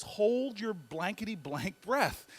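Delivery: slow, deliberate censored threat; slow words. Retold or imitated. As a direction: drag out each word of the order. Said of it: "he says to me in slow words".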